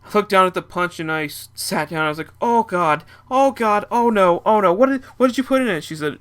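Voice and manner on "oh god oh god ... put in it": high, loud, rushed panic; panicking. Retold or imitated. Drugged man panicking at his friend over the spiked punch. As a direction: spiral into alarm as the drug hits.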